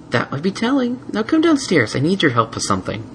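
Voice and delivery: coy, secretive mare; gentle mare voice. Acted. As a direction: keep a poker face. Twilight voiced.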